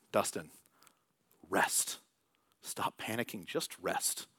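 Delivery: soft, low, unhurried command to rest; quiet fatherly calm; calm God's voice. Pretend. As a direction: soothe a panicking man. God's calming voice in story.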